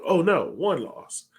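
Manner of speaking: ordinary talk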